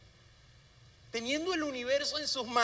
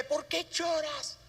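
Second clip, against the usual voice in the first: tender murmur; 3 semitones higher, slower than usual. Pretend. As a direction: coo it to a crying infant. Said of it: "le murmura al oído".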